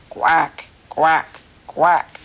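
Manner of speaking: deep nasal-free quacking, one per beat; duck quacks. Shown as a demonstration. Teacher demonstrating a duck sound to children, low quacks. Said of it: "What do ducks say?".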